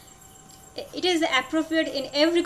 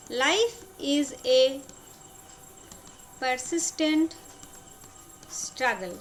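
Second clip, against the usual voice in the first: broken up with long pauses; slow dictation; 2 semitones lower, slower than usual. Shown as a demonstration. Teacher repeating the general rule for students, slow dictation.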